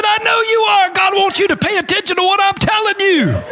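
excited shout. Retold or imitated. Preacher retelling answer to drunk.

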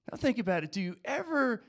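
ordinary talk, nothing marked

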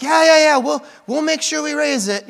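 high, loud, eager yeah-yeahs; eager students. Retold or imitated. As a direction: play the overeager teenagers promising. Students eagerly promising to raise money.

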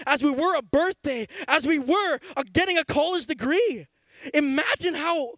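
high, loud, fervent preaching; preaching at full force. Acted. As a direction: stir the crowd up with fire. Preacher building excitement.

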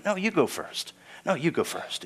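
soft, courteous insistence; quiet after-you politeness; polite deferring. Acted. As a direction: gently wave someone ahead. Acts out giving others preference.

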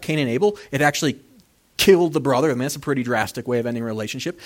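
ordinary talk

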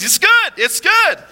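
high, loud cheery optimist; acted irrepressible cheer; super optimistic. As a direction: insist on it brightly, pitch way up. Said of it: "just super optimistic".

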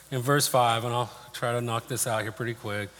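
ordinary delivery

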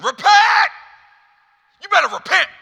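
very high, full-force scream of command; shrieked hellfire order; screaming, yelling. Acted. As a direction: bellow it like a wrathful God. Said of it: "why do we hear this in that tone".

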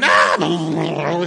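short hammed-up coaster scream; a quick play-acted scream on the drop; mock scream. Preacher imitating a rider screaming over the top. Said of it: "they start screaming".

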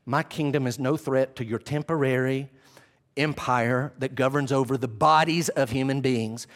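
low, measured reassurance; unhurried, composed and low; calming Jesus. Acted. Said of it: "It's as though the Lord is saying".

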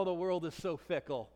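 ordinary delivery

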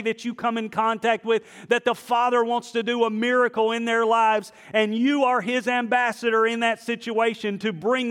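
rally the church to action; preaching at full force. Acted. Pastor urging the congregation to be ambassadors, loud and high.

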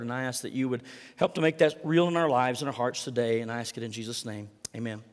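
soft, hushed prayer to God, unhurried; quiet prayer. Acted. Pastor turning from the reading to pray softly over the congregation, hushed prayer.

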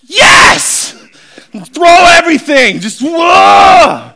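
loud, high, whooping abandon; hammed-up wild excitement; ecstatic yelling. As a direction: yell like you're dropping it all to go. Acting out wild response to call.

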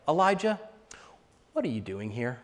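soft, low and hushed; still small voice. Retold or imitated. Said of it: "he speaks to him in a still small voice".

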